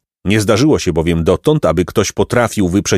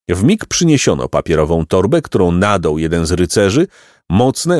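plain reading voice

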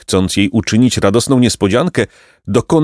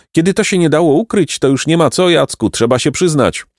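lightly voiced boy, higher, mock-reluctant; sly boy; 8 semitones higher than usual. A narrator's reading. A hint of a sly boy owning up. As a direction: Placek playing along to claim the credit.

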